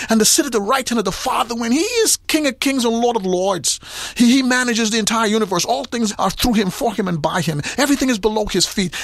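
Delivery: preaching with mounting intensity; preaching at full force. Real, not acted. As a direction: build the fervor line by line. Preaching builds.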